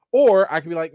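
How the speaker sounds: ordinary delivery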